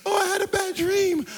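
put-on high-pitched little kid voice; imitated child. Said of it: "Anytime our kids come in".

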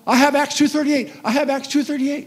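put-on high, loud, frantic old-lady cry; panicked grandma. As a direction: blurt it out like a terrified little grandma. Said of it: "and she goes".

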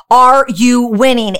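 ordinary talk

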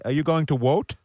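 mock Indian accent, v softened to w; imitated Indian accent. Host mimics Indian mates at election time, Indian accent. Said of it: "all my Indian mates say".

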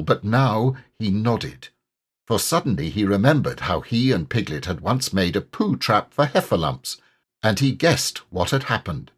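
usual speaking voice

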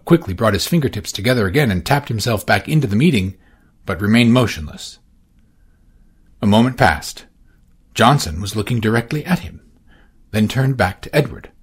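ordinary delivery, nothing marked

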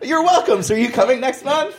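high, loud awkward chirp through laughter. Retold or imitated. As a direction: imitate your own over-eager, awkward reply. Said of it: "really awkward".

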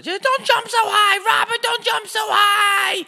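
very high, loud shriek imitating mom; full-blast falsetto mom yell, last words stretched out. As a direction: holler a warning from outside like a worried mom. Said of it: "would yell from outside".

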